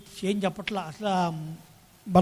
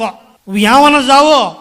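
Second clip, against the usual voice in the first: demanding wife; 4 semitones higher, 12 dB louder, slower than usual. Retold or imitated.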